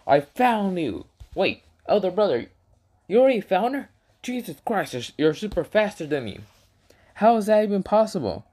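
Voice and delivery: high-pitched mock amazement; astonished retelling. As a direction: act out a younger brother's surprise, voice pitched well up. Acts out Naruto's astonished reaction.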